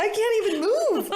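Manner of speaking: put-on high, loud drunken protest; hammed-up wail of being stuck; mock helpless whine. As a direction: whine it loudly, playing helpless. Voicing herself helplessly drunk.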